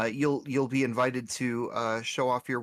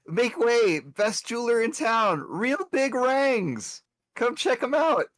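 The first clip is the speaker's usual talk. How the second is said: booming, high, loud hawker call; megaphone voice; 10 semitones higher, 4 dB louder than usual. Acted. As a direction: shout it through a megaphone. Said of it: "as you have the megaphone voice".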